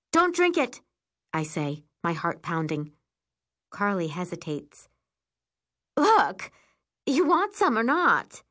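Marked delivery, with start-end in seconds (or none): 0.1-0.8 high, alarmed warning; urgent warning. Acted. Narrator stops Carly drinking from the bottle, urgent. Narrator warns, heart pounding. 6.0-8.4 high, impatient challenge. Acted. Liana offers hesitating Carly her bottle, impatient.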